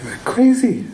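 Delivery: high-pitched laughing mock disbelief; amused disbelief. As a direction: voice his incredulous thought through a laugh. Retells his incredulous thought, laughing.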